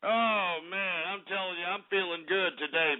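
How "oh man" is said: ordinary talk, nothing marked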